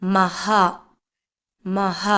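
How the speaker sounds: മഹ low and measured, ending in "ha"; visarga pronunciation. Shown as a demonstration. Teacher modelling ma with visarga for learners, മഹ with clear "ha". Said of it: "അടുത്തത് മായുടെ കൂടെ രണ്ട് കുത്തിട്ടാലോ".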